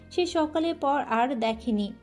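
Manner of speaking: ordinary delivery, nothing marked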